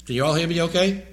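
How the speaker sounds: ordinary talk, nothing marked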